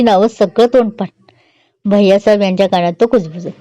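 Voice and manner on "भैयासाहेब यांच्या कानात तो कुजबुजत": usual speaking voice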